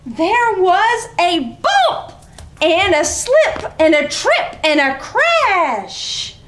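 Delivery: put-on bright read-aloud for small kids; lively story reading. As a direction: read it like a playful picture-book story time. Reading picture book aloud.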